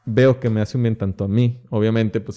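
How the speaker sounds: usual speaking voice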